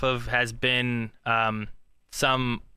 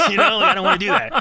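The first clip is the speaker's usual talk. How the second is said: laughing; 9 semitones higher, 8 dB louder than usual. Real, not acted.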